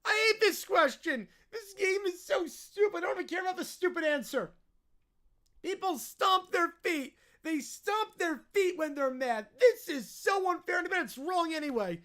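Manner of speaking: put-on stomp through it like a furious child; hammed-up childish outrage; tantrum. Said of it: "one hundred ten percent in tantrums".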